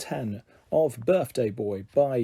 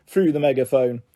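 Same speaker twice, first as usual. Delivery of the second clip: ordinary delivery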